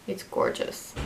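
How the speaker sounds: breathy admiring whisper; soft unvoiced compliment; whispered aside. Real, not acted. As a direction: breathe it out in awe.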